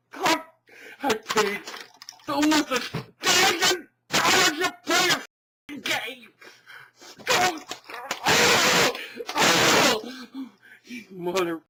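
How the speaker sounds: high, loud anguished yelling; wailing shouts building to a peak; screaming rage. Acted. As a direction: lose it completely over the money. Screaming about money spent.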